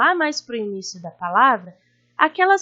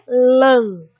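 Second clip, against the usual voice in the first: "lã" drawn out and emphatic; stressed syllable; 7 dB louder than usual. Shown as a demonstration. Teacher naming the strongest syllable for pupils, "lã" stretched and stressed. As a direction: hold the syllable "lã" long and strong. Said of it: "mais forte".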